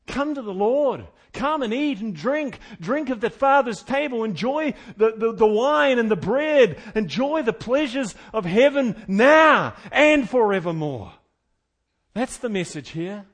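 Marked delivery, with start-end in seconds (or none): 0.1-11.1 loud, ringing pulpit call; fervent preacher's invitation; preaching. Acted. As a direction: call the flock to the table.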